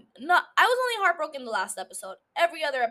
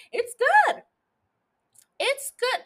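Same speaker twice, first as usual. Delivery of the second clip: high-pitched gushing praise; squealed praise; 11 semitones higher than usual. Real, not acted. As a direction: squeal it out like a fan. Gushing about new show.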